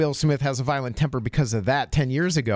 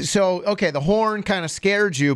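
normal talking voice

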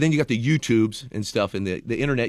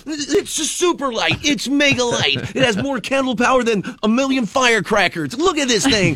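infomercial pitchman; 12 semitones higher than usual. Pretend. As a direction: sell it like a late-night infomercial.

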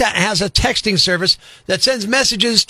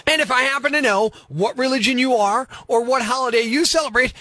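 ordinary talk, nothing marked

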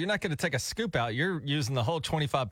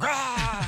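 short higher mock growl of the song; mock rock roar; 9 semitones higher than usual. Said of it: "the song's like".

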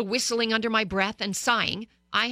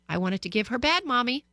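ordinary talk, nothing marked

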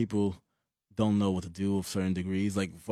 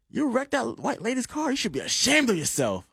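scolding lady; 13 semitones higher than usual. Retold or imitated.

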